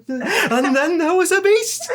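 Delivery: very high, slow, frightened climax; terrified rising squeal. Retold or imitated. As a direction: peak of the fear, drawn out. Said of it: "It'd just be rising through a whole chapter".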